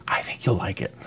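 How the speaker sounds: soft whisper. Real, not acted. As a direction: whisper it close. Quiet closing aside.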